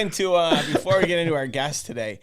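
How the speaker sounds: high, loud, trying to talk through laughter; real laughing fit, voice pushed up high. Real, not acted. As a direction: crack up while trying to get back on topic. Breaks up laughing, tries to resume.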